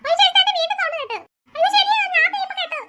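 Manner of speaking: high, loud frightened voices; frightened cry. Acted. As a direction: squeal in alarm at the noise. Kids hear a strange sound.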